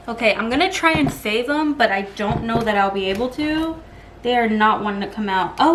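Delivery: usual speaking voice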